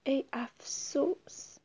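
slow, sorrowful whispered lament; a soft, slow sorrowful whisper; sad sigh. Acted. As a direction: breathe it out in slow regret. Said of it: "با ناراحتی به خودش گفت".

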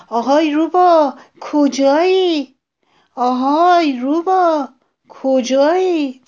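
high, loud, drawn-out calls; calling out. A narrator's reading. Frightened jackal running to find the fox, calling out loudly. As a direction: shout far off for someone, stretching the last word.

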